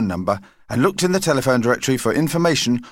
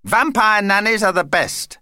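high, breezy insistence building louder; self-important vampire; 11 semitones higher, 4 dB louder than usual. Acted. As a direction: brush objections aside in a high character voice. Vampire Vlad insists on coming.

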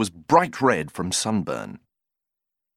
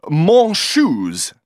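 a louder, higher try at bad French; halting broken French; 6 semitones higher, 7 dB louder than usual. A narrator's reading. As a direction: fumble for the French word. Cyril tries broken French.

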